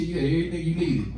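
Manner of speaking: ordinary talk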